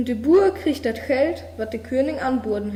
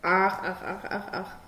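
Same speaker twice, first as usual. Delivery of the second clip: low, soft croaking frog; 3 semitones lower, 5 dB quieter than usual. A narrator's reading. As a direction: croak each ach like a frog by the pond. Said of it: "Die robt erst für dem". (leises Froschquaken)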